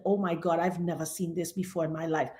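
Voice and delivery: retold stunned, very soft and low; low, quiet astonishment; hushed disbelief. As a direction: imitate a shocked supervisor under her breath. Retold supervisor stunned at screen.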